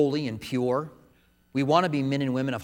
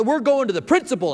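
stern teacher; 10 semitones higher, 5 dB louder than usual. Retold or imitated. Angry teacher quoted.

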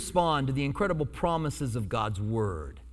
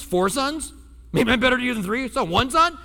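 mock bargaining; 6 semitones higher, 5 dB louder than usual. Husband bargaining down his worth.

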